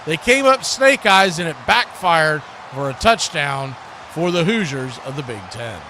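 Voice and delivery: ordinary talk, nothing marked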